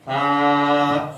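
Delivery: long "ہا" as a soft th, tongue tip on upper teeth; soft, no whistle. Shown as a demonstration. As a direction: draw out "ہا" as th without hardness or whistle. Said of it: "نہ سختی اور نہ ہی سیٹی، نرمی کے ساتھ".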